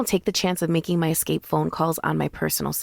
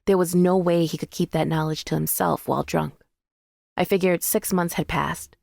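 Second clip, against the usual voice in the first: ordinary delivery, nothing marked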